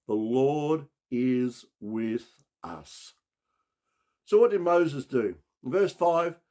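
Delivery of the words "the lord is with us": very slow, drawn-out words for the church to repeat; slow declaration. Shown as a demonstration. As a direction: declare it slowly, leaning on Lord. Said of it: "I want you to declare this".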